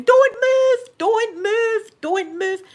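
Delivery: high, loud panicked shouting; mum screaming. Retold or imitated. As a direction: yell it like a frightened mum. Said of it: "my mum was screaming".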